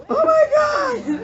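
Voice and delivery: high, loud, drawn-out scream of fright; mock terrified shriek. Lecturer acting out a toddler scared by a veiled mannequin, mock shriek. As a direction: imitate a frightened wail, stretched out and shrill-high.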